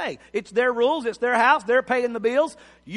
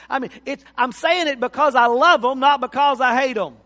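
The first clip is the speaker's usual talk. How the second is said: fervent preaching; 3 semitones higher, 6 dB louder than usual. Acted. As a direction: preach it home to the congregation. Preaching at full force.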